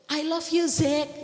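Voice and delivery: cheerful mother's call to her son. Retold or imitated. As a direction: call after your son as he leaves. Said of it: "saya teriak dari dalam mobil".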